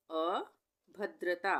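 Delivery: अभद्रता very slow, long pause after अ; broken-up dictation. Shown as a demonstration. Teacher dictating a spelling word, अभद्रता split after अ. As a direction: hold off after अ, then भद्रता.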